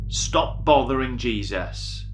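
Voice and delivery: ordinary delivery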